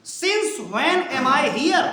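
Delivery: each word drawn out; teacher stretching the full question for students; very slow model reading. Shown as a demonstration. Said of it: "teacher models the question slowly".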